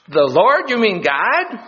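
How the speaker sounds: high, incredulous query; astonished question. Acted. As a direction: ask up the cliff in amazement. Cliff hanger amazed by the voice.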